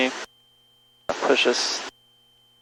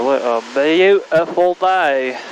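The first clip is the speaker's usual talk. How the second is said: slow, lilting, higher delivery; playful savouring; 4 semitones higher, slower than usual. Pretend. Pilot admires the day aloud, playfully savouring it. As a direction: stretch it out in showy contentment.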